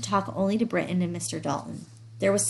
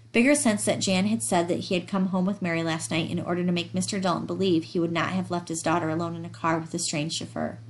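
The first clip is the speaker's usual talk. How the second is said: ordinary delivery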